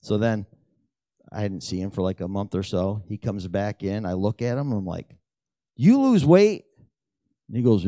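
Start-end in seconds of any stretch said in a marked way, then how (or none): 5.8-6.6 high, loud amazed question; surprised question. Retold or imitated. Shopkeeper seeing old customer much thinner, loud amazed question. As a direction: blurt it out in amazement. Surprised at friend's weight loss.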